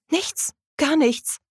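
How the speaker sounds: high, slow, halting denial; flustered stammer. A narrator's reading. Katrina backs down before the indignant man, flustered. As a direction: back down haltingly, pitched right up. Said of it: "stammelte sie".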